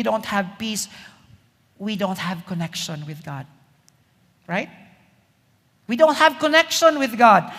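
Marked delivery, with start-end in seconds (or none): 5.9-7.5 louder, higher, driving the point home; emphatic repeat. Real, not acted. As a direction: preach it out with force. Preacher repeats line for emphasis.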